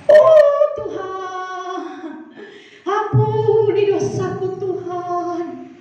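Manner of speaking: long, drawn-out chanted plea; acted wailing prayer. Frightened patient begging God for forgiveness, acted drawn-out wail. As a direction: wail it slowly as a hammed-up prayer.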